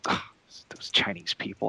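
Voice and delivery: whispered disgusted aside; imitating girlfriend's whisper. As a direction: hiss it quietly to the person beside you. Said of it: "she'll be like".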